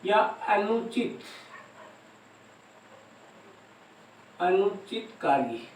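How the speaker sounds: अनुचित कार्य soft and slow, with long pauses; hushed, measured अनुचित कार्य for copying down; slow dictation. Shown as a demonstration. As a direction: dictate अनुचित कार्य quietly at writing speed. Dictating slowly to note-taking students.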